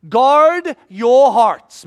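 loud, slow urgent warning; urgent plea. Acted. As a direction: drive the warning home, slowly and loud. Jesus urging disciples.

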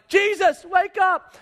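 high, loud urgent call; urgent shout. Acted. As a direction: yell at him to wake.

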